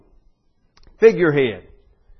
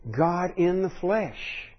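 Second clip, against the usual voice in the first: slow, much softer, almost reverent; hushed awe; 3 semitones higher, 6 dB quieter, much slower than usual. Real, not acted. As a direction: let it fall quietly and slowly. Preacher repeats phrase in awe.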